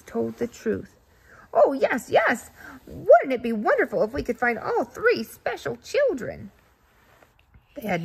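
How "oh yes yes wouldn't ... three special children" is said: louder and higher with joy; thrilled goose agreeing; delighted. Acted. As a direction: gush with excitement.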